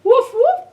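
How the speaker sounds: high, loud mock dog barks; loud barking. Reading aloud to kids, loud high woofs. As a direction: bark big and silly.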